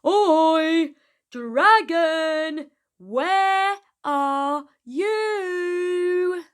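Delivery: loud, high, slow call across a hill; a loud chorus shouting for the hidden dragon; shouted together. Acted. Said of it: "they shouted all together".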